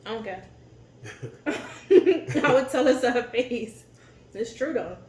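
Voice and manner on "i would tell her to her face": slow, laughing, a bit louder; laughing defiance. Real, not acted. Telling friends she'd say it to the aunt, laughing. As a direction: laugh through a bold claim.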